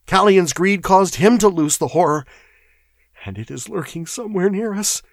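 high-pitched, alarmed clerk; frightened chatter. A narrator's reading. Shaking clerk warning of a lurking horror, frightened. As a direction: warn them with mounting dread. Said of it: "chattered Primero".

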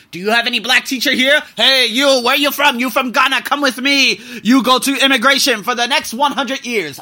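loud, high mock officer barking orders; hammed-up officer. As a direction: imitate a bossy immigration officer, loud. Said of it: "they just say".

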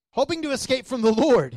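"Hoping to escape from the Lord" said high and loud, hammering each word; rising emphasis. Shown as a demonstration. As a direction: drive the line home harder each time.

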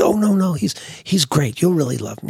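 high, bright-eyed insistence, starting up high; eager friend. Retold or imitated. Debbie urging Larry to meet Hef, in an eager high voice. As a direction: coax him along excitedly.

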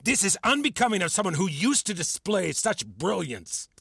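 high-pitched, exasperated reprimand; indignant scolding. Acted. Mentor berating student for arguing back, indignant scolding. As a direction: build from stern rebuke to worked-up tirade.

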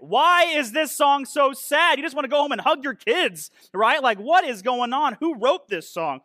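loud, high-pitched comic disbelief; mock exasperation. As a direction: protest the song as if you can't believe it. Mock exasperation at sad lyrics.

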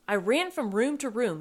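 plain reading voice

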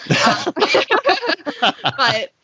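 tearful laugh breaking into crying; laughing through tears. Real, not acted. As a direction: laugh while holding back tears. Daughter tears up over father.

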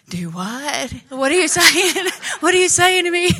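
rising pitch, laughing disbelief; stunned disbelief. Retold or imitated. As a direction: replay the shock of good news. Told she gets the promotion.

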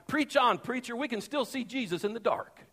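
a light imitation of a shouting deacon; retold deacon's shout. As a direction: cry out to the preacher from the pews. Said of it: "stood up and shouted".

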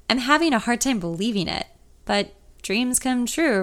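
ordinary delivery, nothing marked

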